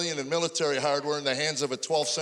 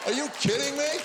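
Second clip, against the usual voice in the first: exasperated mock outrage; exasperated shout; 8 semitones higher than usual. Comic outburst at audience.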